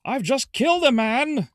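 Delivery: high, loud shouted confession; movie villain proclaiming. Retold or imitated. Bad guy announcing his crime to a real world, loud mock proclamation. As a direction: call it out proudly to the whole street. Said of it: "bad guy, coming to the real world".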